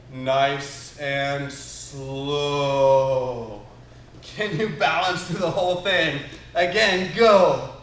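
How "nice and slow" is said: hugely stretched, drawn-out words; slow-motion voice. Shown as a demonstration. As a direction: drag each word out in slow motion. Leading slow-motion drill.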